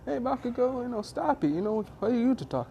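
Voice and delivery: lightly acted classmates telling someone off; indignant classmates.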